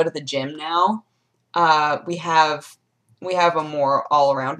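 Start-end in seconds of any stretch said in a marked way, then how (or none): none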